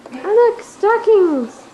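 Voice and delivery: high, loud, delighted cry; excited squeal. Real, not acted. Child excited by decorations.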